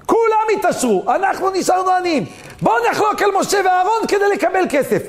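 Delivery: high-pitched, indignant complaint; rally the others with a resentful outcry; acted grievance, pitched well up; aggrieved protest. Acts out Datan and Aviram's grievance.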